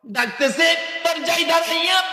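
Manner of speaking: high-pitched preacher's storytelling cry; impassioned narration. A narrator's reading. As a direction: lift the narration high towards the greeting. Raised sermon narration. (চড়া গলায় বর্ণনা)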